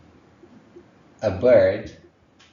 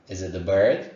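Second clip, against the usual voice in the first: ordinary talk, nothing marked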